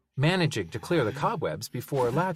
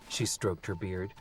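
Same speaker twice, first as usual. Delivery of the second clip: usual speaking voice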